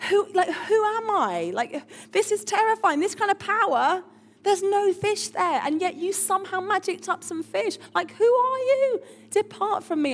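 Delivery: a touch of frightened awe; acted awestruck alarm. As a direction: play someone shaken by a power they can't explain. Acts Simon overwhelmed by the miracle.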